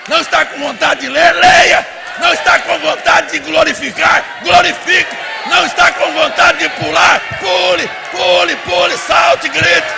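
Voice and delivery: loud, high preaching, shouted commands; full-force exhortation. Real, not acted. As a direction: fire off each command to rouse the crowd.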